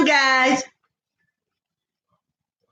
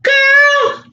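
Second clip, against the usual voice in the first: exasperated shout; 14 semitones higher, 6 dB louder than usual. Real, not acted. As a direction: snap at her, loud and high. Scolding granddaughter over crackers.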